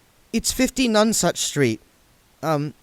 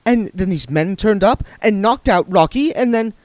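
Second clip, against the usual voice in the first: higher, louder, fast nervous babble; nervous rush; 12 semitones higher, 5 dB louder than usual. A narrator's reading. Saccharissa explains to William, nervous, fast and high. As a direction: gabble it out nervously. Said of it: "said Saccharissa nervously".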